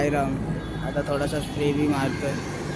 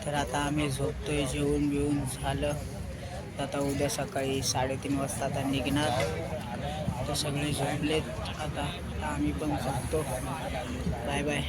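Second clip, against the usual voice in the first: quiet at night; 10 dB quieter than usual. Real, not acted. Others asleep, saying goodnight.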